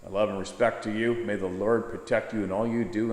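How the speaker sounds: normal talking voice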